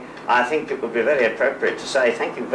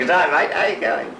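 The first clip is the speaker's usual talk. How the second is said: bright, higher-pitched Aussie greeting; a cheery G'day mate, pitched up; Australian accent; 5 semitones higher, faster than usual. Shown as a demonstration. Guest repeating the Australian greeting for the laughing host, Australian accent. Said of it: "They say".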